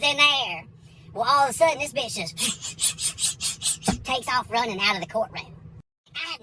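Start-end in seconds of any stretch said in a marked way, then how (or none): 2.4-4.0 quick, voiceless rhythmic shuffling sounds; imitated scurrying noise. Old lady bolting from court, mimicked scurrying. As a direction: puff out a rapid run of hissy footstep noises.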